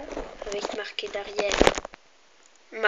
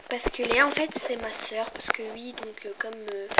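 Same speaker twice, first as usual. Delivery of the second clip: usual speaking voice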